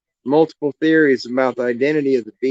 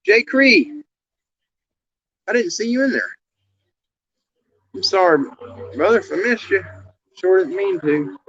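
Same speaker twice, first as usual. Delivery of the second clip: friendly greeting; 6 semitones higher than usual. Real, not acted. Greeting a viewer in chat.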